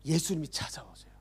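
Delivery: whispered, awed; hushed. A narrator's reading. Preacher announcing Jesus' arrival in a hushed whisper.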